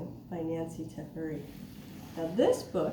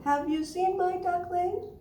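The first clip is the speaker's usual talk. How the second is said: high-pitched rising question; mother duck asking; 11 semitones higher, 5 dB louder, slower than usual. Acted. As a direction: ask it high, climbing to the end. Mother duck asks again.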